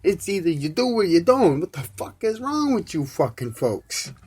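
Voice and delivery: high, heated swearing tirade; angry rant. Real, not acted. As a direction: vent hard at the folks listening. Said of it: "that's my twenty-five minute fucking rant".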